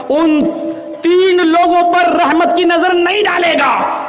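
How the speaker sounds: high, loud, slow warning; preacher driving home a hadith, high and loud; fiery preaching. Acted.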